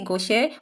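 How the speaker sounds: "gauche" with the final e voiced, go-shay; "gauche" ending in a clear é; sounded final e. Shown as a demonstration. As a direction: say "gauche" as two syllables, go-shay. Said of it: "You can say gauche".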